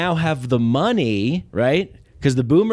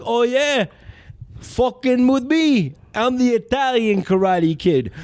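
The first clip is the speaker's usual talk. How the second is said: higher, swaggering tough guy; cocky hero voice; 8 semitones higher than usual. Retold or imitated. Italian Karate Kid boasting about who he is. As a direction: announce yourself with swagger. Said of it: "He's like".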